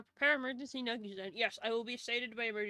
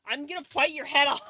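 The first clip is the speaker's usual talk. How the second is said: louder playful threat through a laugh; laughing mock threat; 4 semitones higher, 8 dB louder, faster than usual. Mock shark threatening her friend, laughing.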